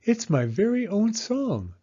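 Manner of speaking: put-on high, eager child voice; a small girl begging her mother to listen; little girl Penny. Little Penny asks Mama to listen, high and eager.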